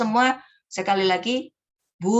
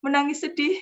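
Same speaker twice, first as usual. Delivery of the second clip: higher, amused telling of sad news; amused sad line; 7 semitones higher than usual. Shown as a demonstration. Beginner storyteller tells of crying child, amused. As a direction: tell the sad part as if finding it funny. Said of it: "menangis sedih tapi wajahnya ketawa".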